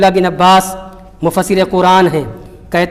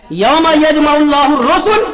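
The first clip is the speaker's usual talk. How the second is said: slow, higher chanted recitation of the verse; Quranic recitation; 6 semitones higher, slower than usual. Acted. Preacher reciting scripture to the congregation, chanted. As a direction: intone the Arabic verse slowly.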